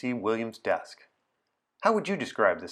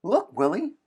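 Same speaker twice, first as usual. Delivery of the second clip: much higher, louder playful call; eager call; 8 semitones higher, 7 dB louder than usual. Acted. Anna calling William by nickname, eager and high.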